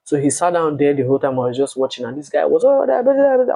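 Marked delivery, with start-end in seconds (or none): 2.6-3.5 mock blah blah. Mocking ex's ranting.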